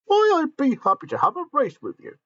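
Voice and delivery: high, loud eager character voice; excited Gordon. Acted. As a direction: squeal it out with delight. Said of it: "said Gordon".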